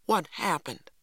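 alarmed question, kept contained; hushed exclamation. A narrator's reading. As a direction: ask it aghast. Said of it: "Stacy exclaimed in a whisper".

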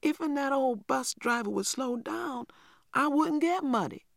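put-on high child's protest; a small boy protesting in a high voice; protesting little boy. A narrator's reading. Little boy protests to grandmother in a high voice. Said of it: "Little Man protested".

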